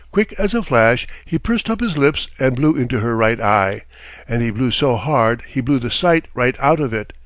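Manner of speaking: usual speaking voice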